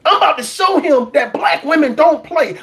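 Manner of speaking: usual speaking voice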